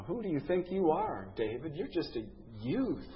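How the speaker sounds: put-on king dismissing the young shepherd, quietly scornful. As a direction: brush the boy off with quiet contempt. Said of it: "stopping short of calling him a bloomin' idiot".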